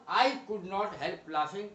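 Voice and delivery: deliberately slow example sentence; slow dictation. Shown as a demonstration. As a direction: read it slowly to the class. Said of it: "teacher repeating example sentence".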